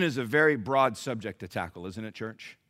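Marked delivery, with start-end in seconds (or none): none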